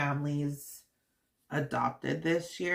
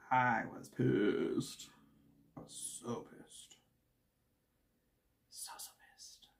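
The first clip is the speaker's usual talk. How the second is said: fading to whisper; 5 semitones lower, 9 dB quieter than usual. Real, not acted. Confessing to viewers how pissed she was, fading to whisper. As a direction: let the anger drain into a whisper.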